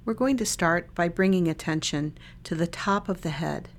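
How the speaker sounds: calm body-scan guidance with long silences between phrases; unhurried, even meditation-guide voice; guided meditation. Real, not acted. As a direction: lead listeners gently through a meditation, leaving lots of space.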